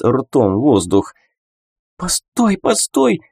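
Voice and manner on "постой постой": high-pitched, pleading woman's voice; choked female plea. A narrator's reading. Shadow woman grabbed by the throat, begging in a stifled voice. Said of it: "взмолилось оно придушенным, но явно женским голосом".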